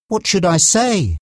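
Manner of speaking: high, mildly anxious question; unsure, worried plea for guidance; nervous. A narrator's reading. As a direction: ask nervously with the pitch up. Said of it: "Mark asked nervously".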